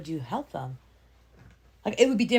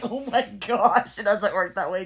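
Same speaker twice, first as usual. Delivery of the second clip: slow, louder exasperated disbelief; exasperated mom; 2 semitones higher, 4 dB louder, slower than usual. Retold or imitated. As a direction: drag it out like a fed-up parent. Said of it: "I'm like".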